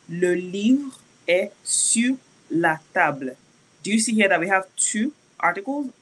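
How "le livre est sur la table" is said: "Le livre est sur la table" very slowly; slow model reading of "Le livre est sur la table"; slow French model. Shown as a demonstration.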